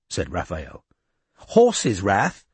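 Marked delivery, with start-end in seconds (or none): 1.6-2.4 impatient. A narrator's reading. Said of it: "said Izzy impatiently".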